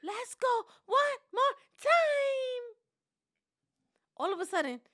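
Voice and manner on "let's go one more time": put-on much higher, louder, slow little-girl plea; eager nieces. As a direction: beg sweetly like an excited niece. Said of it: "my two nieces".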